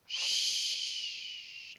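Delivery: "ch" as a long hiss through rounded lips; rounded-mouth SH. Shown as a demonstration. Teacher showing mouth position for the sound, long SH. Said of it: "la bouche est toute ronde".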